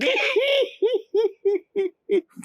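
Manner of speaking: high shrieking belly laugh; a boy cracking up; helpless laughter. A narrator's reading. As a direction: laugh till you roll over. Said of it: "rolling around on the ground laughing".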